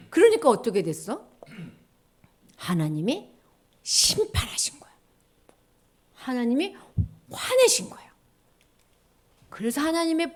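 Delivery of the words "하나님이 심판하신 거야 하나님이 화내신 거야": usual speaking voice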